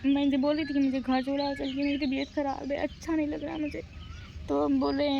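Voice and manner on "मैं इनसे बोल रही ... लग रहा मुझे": higher, crying voice through the words; retelling to viewers while still crying; tearful. Real, not acted. Telling viewers about wanting to go home, tearfully. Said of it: "मुझे रोना आ गया यार".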